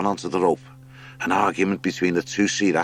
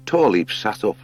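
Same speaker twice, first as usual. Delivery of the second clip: plain reading voice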